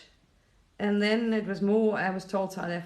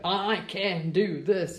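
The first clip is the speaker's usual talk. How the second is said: slow, drawn-out voice of the ego; much slower than usual. Acted. Speaker voicing the ego, slow and self-assured. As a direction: stretch it out as the ego talking. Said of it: "This is the ego".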